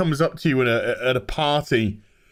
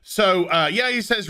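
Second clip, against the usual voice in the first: ordinary delivery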